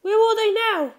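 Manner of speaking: put-on high curious girl voice; Chiho, high girl voice. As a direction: pitch way up like a curious schoolgirl. Narrator voices Chiho's question.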